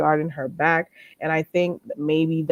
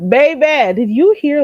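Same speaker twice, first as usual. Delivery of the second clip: excited; 8 semitones higher, 9 dB louder than usual. Real, not acted. Excited call to chat.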